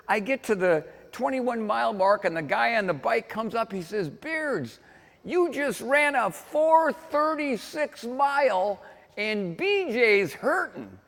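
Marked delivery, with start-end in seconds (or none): 4.1-11.0 high, slow, stretched-out call; volunteer calling out. Retold or imitated. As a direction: act out a bystander calling the split to a runner. Same volunteer calls out next split.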